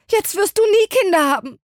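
hoher, lauter verzweifelter Ausruf. A narrator's reading. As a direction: jammere theatralisch auf. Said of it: "sagte Misses Bennet verzweifelt". (Mutter jammert verzweifelt über Janes Trennung)

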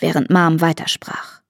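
usual speaking voice

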